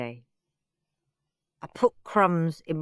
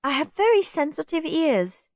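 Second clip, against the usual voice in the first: whiny Margaret; 10 semitones higher than usual. Acted. Fussy guest Margaret complaining.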